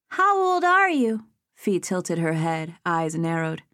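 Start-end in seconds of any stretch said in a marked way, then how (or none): 0.1-1.3 put-on a small girl sizing him up; little girl voice. A narrator's reading. Child asks age.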